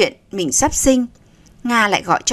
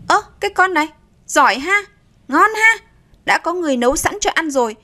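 high, mocking outrage; indignant sarcasm; 7 semitones higher, faster than usual. A narrator's reading. Aunt answering the girl's defiance, sarcastically indignant. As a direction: pitch up sharply in scornful disbelief. Aunt reacts to being defied.

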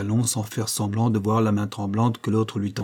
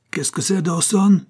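raised, higher voice demanding an answer; crying out; 8 semitones higher, 5 dB louder than usual. A narrator's reading. Master catches his groom with strangers, crying out. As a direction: shout the question at an underling. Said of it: "cria-t-il".

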